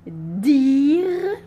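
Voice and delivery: loud, clear dictation of Dire; emphatic dictation. Shown as a demonstration. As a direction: call out Dire to the class. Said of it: "Mot de la dictée".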